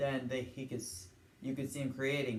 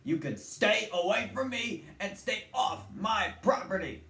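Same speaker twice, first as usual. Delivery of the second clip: much higher, louder cartoon grump voice; grumpy neighbor; 10 semitones higher, 4 dB louder than usual. Mister Talbot chasing the Chipmunks off his yard, higher and louder. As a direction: warn the kids off like an angry neighbor. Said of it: "Mister Talbot says".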